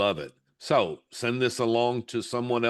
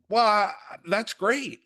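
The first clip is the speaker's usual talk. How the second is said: much higher, slow and bright encouragement; cheerful reassurance; 11 semitones higher, 4 dB louder, slower than usual. Retold or imitated. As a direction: lift her up over a good review. Mother reassuring daughter.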